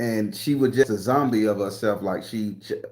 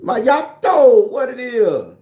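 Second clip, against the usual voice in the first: excited greeting; 10 semitones higher, 7 dB louder, much slower than usual. Real, not acted. Greeting viewer, high loud.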